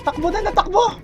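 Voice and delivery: fast, loud and high, fleeing in panic; panicked urgent yelling. Real, not acted. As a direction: shout like you're being chased. Fleeing enemy in game.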